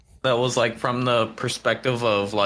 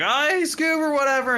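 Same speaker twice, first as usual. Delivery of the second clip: much higher, goofy stoner Shaggy. Retold or imitated. Retelling a TikTok to friends, high goofy Shaggy. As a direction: squeak it out like a lazy Shaggy. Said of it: "he's like".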